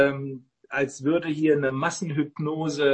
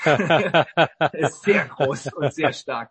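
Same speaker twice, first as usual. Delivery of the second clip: laughing through the words, slower and louder at the end; amused laughing; 5 dB louder, much slower than usual. Real, not acted. Joking to co-host about a protective dog, laughing. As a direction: chuckle while talking, stretching it out.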